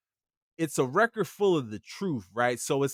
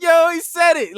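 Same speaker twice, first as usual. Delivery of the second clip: high, loud excited yelp; hyped exclamation; 14 semitones higher, 8 dB louder than usual. Retold or imitated. Fan in the video yelling, thrilled and loud. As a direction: shout it like you just got the line. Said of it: "And he's like".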